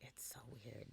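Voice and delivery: soft unvoiced whisper. Real, not acted. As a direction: breathe it without voice. Repeats line, then whispers it.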